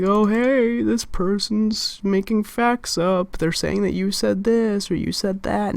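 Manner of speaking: a person reporting her to D E in a tattling voice; mock tattletale. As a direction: tattle like a snitch telling on her. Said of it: "Don't go and tell D E and be like".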